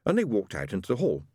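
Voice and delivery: usual speaking voice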